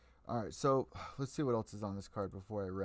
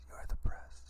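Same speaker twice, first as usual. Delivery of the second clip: stage whisper. Acted. Repeating the point to media.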